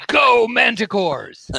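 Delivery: high, drawn-out rallying yell; hammed-up team-spirit holler; acted cheer. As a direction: shout like a fan cheering the team on. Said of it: "also yells".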